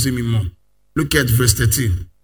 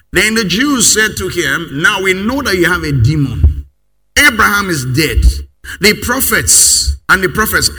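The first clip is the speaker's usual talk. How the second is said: loud, higher scripture reading with rushing stretches; full-force preaching; 4 semitones higher, 6 dB louder than usual. Acted. Pastor reading John to the congregation, forceful preaching. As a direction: proclaim the verses to the crowd.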